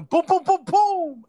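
loud, high rapid-fire booms; imitated gunshots. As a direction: boom out the gunshots, voice jumping up. Imitates gunfire in retold story.

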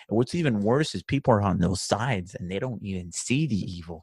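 usual speaking voice

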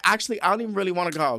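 high, loud, rushed glee; excited. Pretend. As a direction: gush rapidly like a delighted prisoner. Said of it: "I'd be so excited".

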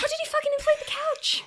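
high-pitched comic exasperation; incredulous echo. Real, not acted. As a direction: pile on in high disbelief. Echoes the co-host's disbelief.

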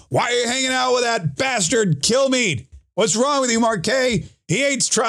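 high-pitched imitation of indignant listeners; mock outrage. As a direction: mimic angry fans demanding answers. Acts out outraged listeners' messages.

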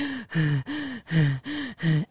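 imitated winded panting; heavy gasps, as if just up the stairs; out-of-breath gasping. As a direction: pant hard to show being winded.

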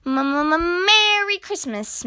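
high, hesitant stuttered greeting; timid stammer. A narrator's reading. Mole nervously greets Grumpy Badger at his door, timid stammer. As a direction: stumble on the first sound, then rise high.

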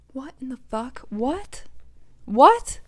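incredulous. Retold or imitated. Retold shock at being dropped.

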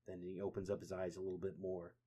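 ordinary delivery

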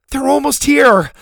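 very high, loud warning cry; alarmed shout. Acted. Zeta warning Boom of the warriors, alarmed shout.

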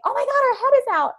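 high, loud gasp of disbelief; mock panic at the sight; shocked cry. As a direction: blurt it out stunned and fast. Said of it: "I looked down and I was like".

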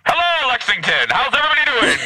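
high, bright radio host greeting; high mock announcer welcome; Dawn's host voice. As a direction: play the pro broadcaster, up top. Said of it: "Dawn's like".

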